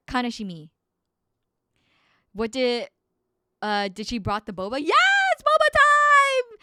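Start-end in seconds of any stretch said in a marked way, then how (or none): 4.8-6.6 excited. Real, not acted. Excited boba arrival.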